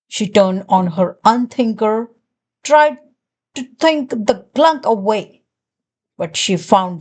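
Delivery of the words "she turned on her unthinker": plain reading voice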